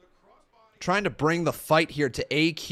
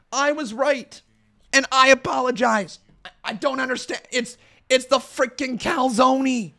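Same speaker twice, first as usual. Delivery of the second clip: higher and louder, exasperated; vindicated outrage; 9 semitones higher, 5 dB louder than usual. Real, not acted. To his viewers after seeing the spelling, exasperated high outcry. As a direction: cry out at the injustice of apologizing.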